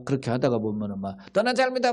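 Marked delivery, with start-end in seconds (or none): 1.3-1.9 taunting passerby. Retold or imitated. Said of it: "막 그렇게 뭐".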